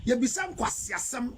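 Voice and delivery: usual speaking voice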